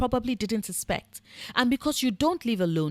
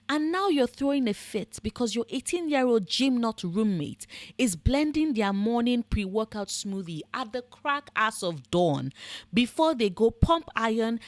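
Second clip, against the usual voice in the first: ordinary delivery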